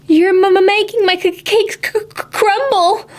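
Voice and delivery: high, stammering worried baker; hammed-up nervous stutter. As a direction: stutter it out in a fret. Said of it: "worried the baker".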